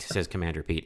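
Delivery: usual speaking voice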